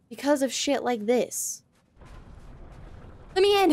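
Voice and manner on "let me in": yelling to be let in. Real, not acted. Gamer yells at locked door.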